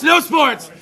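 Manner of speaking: loud chanted punchline; shouted hook. Retold or imitated. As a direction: yell it like a rap hook. Shouting a line from song.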